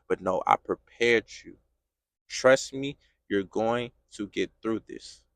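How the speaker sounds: very low, soft fatherly voice of God; quiet reassurance. Acted. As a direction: reassure a hurting son in hushed tones. God calmly answers the protest.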